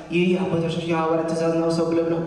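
mock rushed recital on a low monotone; too-fast recitation. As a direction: rattle it off like a rote prayer. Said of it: "we would say it too fast".

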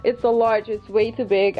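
ordinary talk

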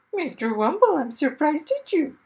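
talking parrot scolding its owner. Acted. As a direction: scold him like a prim parrot. Parrot speaks by his ear.

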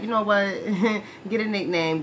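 usual speaking voice

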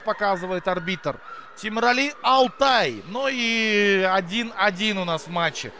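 ordinary talk

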